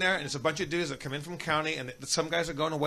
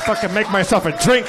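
much louder outburst; loud exclamation; 5 semitones higher, 12 dB louder than usual. Real, not acted. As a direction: announce it loudly to the room. Shouting about getting a drink.

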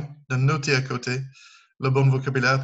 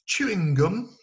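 a hammed-up English accent on Chewing gum, pitched higher; 6 semitones higher, slower than usual. Shown as a demonstration. Teacher shows the class her best English accent. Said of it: "Sortez votre meilleur accent anglais".

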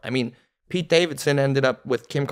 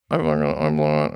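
low grumbled self-reproach; mock inner voice, sunk low; imitated inner despair; 10 semitones lower than usual. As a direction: drop the voice way down into private defeat. Voicing inner thoughts during failed set.

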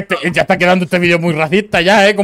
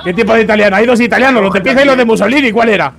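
mock interrogation; 5 semitones higher, 5 dB louder, faster than usual.

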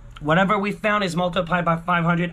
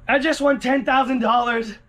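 normal talking voice

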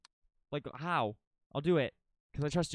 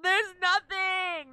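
much higher and slow, stretched out in disbelief; stunned; 14 semitones higher, 6 dB louder, slower than usual. Real, not acted. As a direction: drag it out, floored. Shocked nothing is there.

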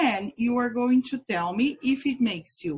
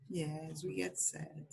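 usual speaking voice

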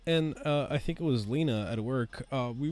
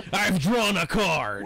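slow, raised-pitch mock holler; retold yelling; 5 semitones higher, 4 dB louder, slower than usual. Duelist re-enacting a card draw across the park, mock holler. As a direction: stretch it out like calling across a park. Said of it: "I was just yelling from across".

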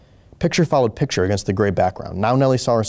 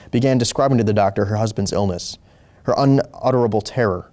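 ordinary delivery

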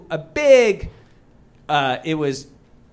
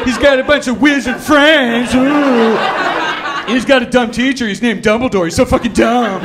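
frustrated writer's loud, raised mock whine; J K Rowling; 5 semitones higher, 6 dB louder than usual. Failed author describing her wizard book, loud scornful whine. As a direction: pour scorn on your own story. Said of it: "in her frustration".

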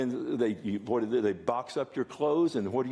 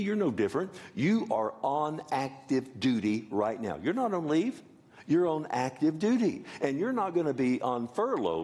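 preaching; 3 semitones higher than usual. Real, not acted. Preaching at full force.

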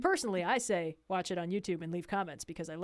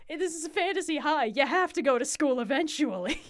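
mock exasperation; 6 semitones higher, 4 dB louder than usual. Acted game master pleading with player.

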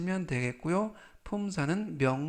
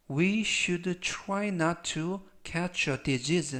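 each word spaced out slowly for learners to repeat; slow model sentence for students to repeat after; slow, clear reading; 3 semitones higher, much slower than usual. Shown as a demonstration. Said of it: "제가 역시 천천히 세 번씩 읽어드리고요".

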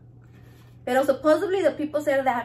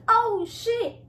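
retold shock; 10 semitones higher than usual. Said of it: "And I was like".

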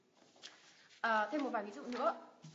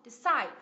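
"Decide" with a strong, falling CIDE; second-syllable stress; 2 semitones lower, 5 dB louder than usual. Shown as a demonstration. Teacher models the verb Decide, second syllable stressed. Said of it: "nhấn vào âm tiết thứ".

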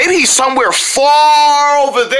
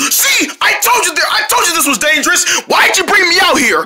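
high-pitched frantic protest; panicked blame; 5 semitones higher than usual. Pretend. Sark blames siblings while fleeing the bear, panicked. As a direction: wail I-told-you-so in a panic.

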